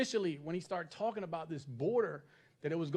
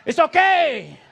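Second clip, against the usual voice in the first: high, loud, easygoing driver voice; hammed-up accommodating driver; 6 semitones higher, 13 dB louder than usual. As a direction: wave off the crash, loud and breezy. Mexican driver after crash.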